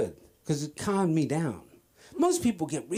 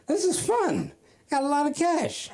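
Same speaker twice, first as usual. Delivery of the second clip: high-pitched mock glee; gleeful; 12 semitones higher than usual. Comic reliving his drug-selling windfall, high and gleeful. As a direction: squeal it like a delighted kid. Acting out his joy at dealing.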